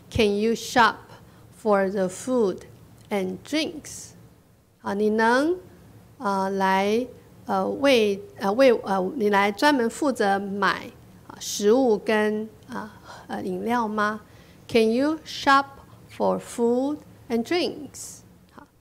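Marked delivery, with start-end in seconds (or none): 0.1-4.1 slow, phrase by phrase with pauses; drawn-out words, pausing between chunks; slow model reading. Shown as a demonstration. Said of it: "跟我念啊". 14.7-18.2 slow, phrase by phrase with pauses; drawn-out words, pausing between chunks; slow model reading. Shown as a demonstration. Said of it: "我们慢慢读".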